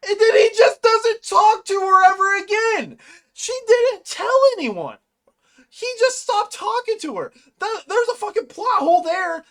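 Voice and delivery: very high, loud indignant rant; exasperated outburst. Real, not acted. Fan rants about a plot hole, exasperated.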